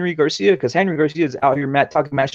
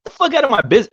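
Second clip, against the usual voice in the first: raised, heated mock retort; angry retort; 9 semitones higher, 4 dB louder, faster than usual. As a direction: snap it back at him. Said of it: "I was like".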